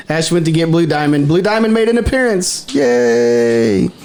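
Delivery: normal talking voice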